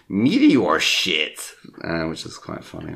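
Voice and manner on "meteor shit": slow, louder goofy farmhand; mock yokel farmer. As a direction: drag it out like a dopey farmer. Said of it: "he goes".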